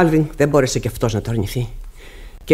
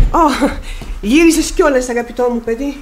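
delighted greeting; 8 semitones higher than usual. Acted. Mother greets returning son.

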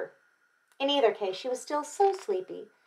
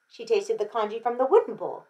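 plain reading voice

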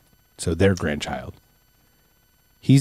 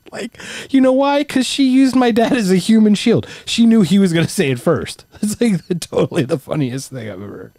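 laughing; 8 semitones higher than usual. Real, not acted. Laughing over mom's trick.

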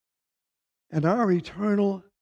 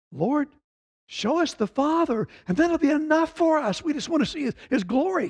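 higher, eager plea of a disciple; a disciple longing, in a raised, pleading voice; pleading Philip; 9 semitones higher than usual. Acted. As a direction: beg to see the Father, pitched up high.

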